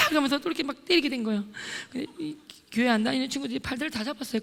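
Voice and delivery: ordinary talk, nothing marked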